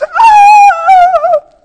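very high, loud mock wail; long high-pitched cry, imitating uncontrolled sobbing; imitated crying wail. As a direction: wail it out like someone falling apart. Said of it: "She's crying out of control".

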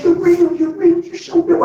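high, thrilled cry of disbelief; retelling stunned joy, pitched high; amazed exclamation. Retold or imitated. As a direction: burst out in amazement. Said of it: "I was like".

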